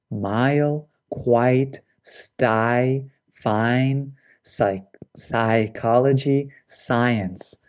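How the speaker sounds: each word drawn out with space around it; slow, careful pronunciation model; lengthened vowel sounds. Shown as a demonstration. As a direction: stretch the long I so both parts are clear. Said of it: "I am lengthening the sound".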